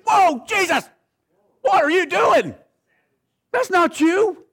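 high, loud squawk of alarm; very high, shouted protest; shocked disbelief. Acted. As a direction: cry out in mock shock at Jesus. Preacher's shocked reaction to Jesus' words.

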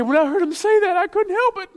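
high, bright mock voice of the wife; wife laughing it off. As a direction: pitch it way up like she can't hold back. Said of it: "And she said".

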